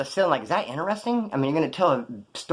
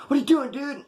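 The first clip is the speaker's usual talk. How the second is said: high-pitched imitated outrage, not shouted; got all mad; 11 semitones higher than usual. Dealer objects to the joint he grabbed, mad. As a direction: protest indignantly, voice jumping up high. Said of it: "Got all mad".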